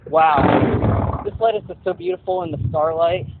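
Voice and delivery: a loud, marvelling "Wow"; awed wonder. Retold or imitated. Man staring at lettuce in the gutter, loud and marvelling. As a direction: gush over the lettuce in awe. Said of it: "And he's like".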